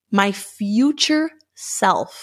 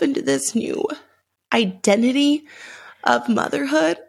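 higher, halting voice holding back tears; real emotional welling-up; 3 semitones higher than usual. Real, not acted. Pregnant daughter fearing mom won't be there, getting emotional. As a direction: push through a painful memory in broken phrases. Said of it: "I'm gonna get emotional".

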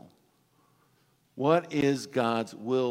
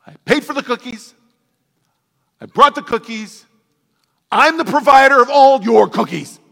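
loud, much higher mock outrage at the toddler; shouting back; 10 semitones higher, 18 dB louder than usual. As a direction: bellow it like an exasperated parent. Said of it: "you just want to shout back".

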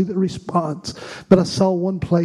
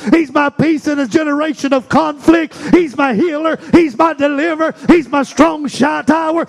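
full-force preaching; 7 semitones higher than usual. Acted. Preacher rousing the church against the devil, shouting.

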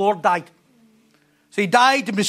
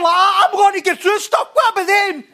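raised, louder whiny voice; mock worry; 8 semitones higher, 4 dB louder than usual. Preacher voicing a listener fretting, raised and whiny. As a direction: fret about the others.

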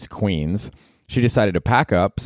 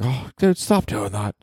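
embarrassed friend; 5 semitones higher, slower than usual. Retold or imitated. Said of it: "J D's like".

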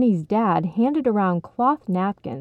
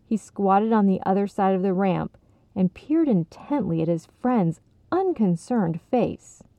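ordinary delivery, nothing marked

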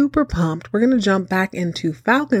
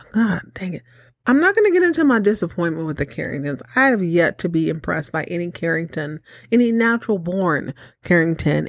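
ordinary delivery

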